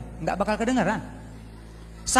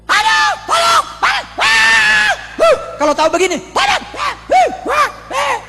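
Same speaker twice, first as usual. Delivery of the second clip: shrieking in agony; 11 dB louder than usual. Acted. A soul in hell begs for help, shrieking high and loud in pain. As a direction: scream as if burning in fire.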